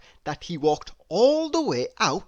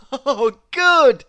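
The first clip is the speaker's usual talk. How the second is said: high, loud delighted cry; bubbling over with glee; Mister Happy thrilled at the news; cheerful Mister Happy; 8 semitones higher, 11 dB louder than usual. Acted.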